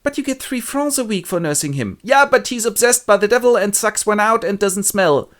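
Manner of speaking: high, loud put-on nurse voice; nurse complaining. Nurse demands Père Terrier take the baby back. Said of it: "Père Terrier goes".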